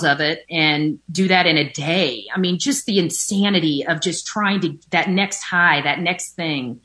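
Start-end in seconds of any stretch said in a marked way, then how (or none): none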